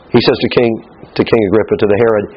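ordinary talk

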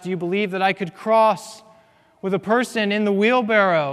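Blondin. Retold or imitated. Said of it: "Charles Blondin asks".

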